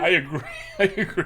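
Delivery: high, giggly words; amused, laugh breaking up the words; laughing agreement. Real, not acted. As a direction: agree through real laughter. Laughing along about the monster joke.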